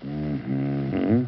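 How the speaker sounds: husband half-listening to his wife, grunting replies; distracted grunting. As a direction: grunt along without really listening. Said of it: "you're grunting and not listening".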